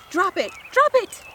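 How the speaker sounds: high, loud, escalating order; urgent command. Acted. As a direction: shout to make a dog let go.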